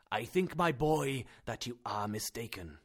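patient, kindly tone; gentle correction. Acted. Uncle gently corrects Axel.

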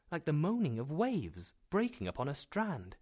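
usual speaking voice

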